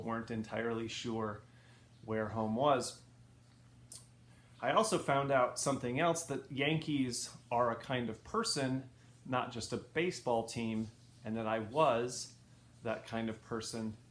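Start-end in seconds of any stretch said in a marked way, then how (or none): none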